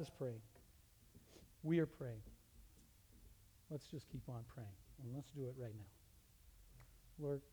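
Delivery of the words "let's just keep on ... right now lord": prayer. Acted. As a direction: quietly lead them in prayer. Leads a prayer.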